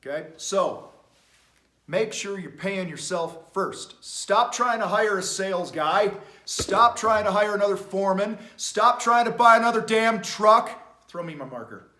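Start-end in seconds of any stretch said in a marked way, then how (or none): 4.0-10.7 loud, building exhortation; passionate. Real, not acted. Coach telling owners to stop spending, passionate and loud. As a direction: drive each order home louder than the last. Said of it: "You can tell I'm pretty damn passionate".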